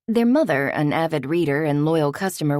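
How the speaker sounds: ordinary delivery, nothing marked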